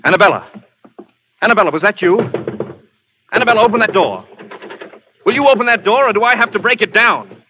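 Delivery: growing alarm, shouting at the door; calling through a door. Acted. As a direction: demand she open the door, rising in alarm. Man hammering at a locked door.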